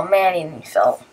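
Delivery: normal talking voice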